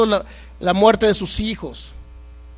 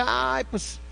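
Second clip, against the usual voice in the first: mock suffering; 7 dB quieter than usual. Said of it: "ideología de que".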